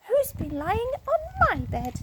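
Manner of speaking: high, slow mother bear voice. A narrator's reading. Mother bear asking who lay on her bed, high and slow. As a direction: sing it out like a fussy mama bear. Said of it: "said Mother Bear".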